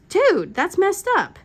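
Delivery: put-on high, loud, drawn-out teen protest; appalled teenager. Mother mimicking her teenager telling dad off, appalled. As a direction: imitate a scandalized teen, stretching the words.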